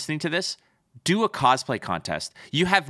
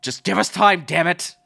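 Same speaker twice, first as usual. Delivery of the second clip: exasperated plea; 5 semitones higher, 6 dB louder than usual. Retold or imitated. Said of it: "was a little bit like".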